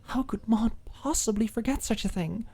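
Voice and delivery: ordinary talk, nothing marked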